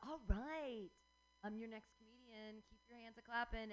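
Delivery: ordinary talk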